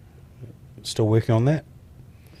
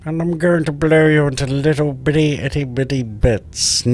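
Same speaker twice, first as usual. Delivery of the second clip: high, loud unhinged villain threat; evil voice; 8 semitones higher, 4 dB louder than usual. Acted. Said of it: "That's my evil voice".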